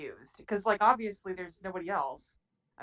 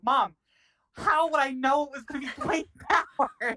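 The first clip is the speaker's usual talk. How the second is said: baffled; 6 semitones higher, 4 dB louder than usual. Retold or imitated. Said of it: "I just looked at her baffled".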